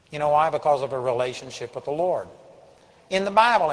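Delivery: ordinary delivery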